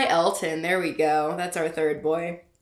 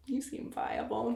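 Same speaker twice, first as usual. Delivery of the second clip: much softer, slow deadpan "You seem viable."; 5 semitones higher, 10 dB quieter than usual. Shown as a demonstration. Rereading her line, softer and deadpan. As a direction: mutter "viable" flat and soft. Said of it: "This is probably how I talked".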